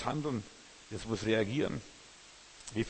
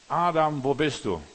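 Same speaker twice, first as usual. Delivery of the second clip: calling out; 3 semitones higher, 8 dB louder than usual. Acted. God calls out to Adam.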